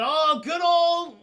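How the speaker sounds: slow, loud, high drawn out; hammed-up gleeful build-up; excited aside. Real, not acted. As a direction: stretch it out with glee.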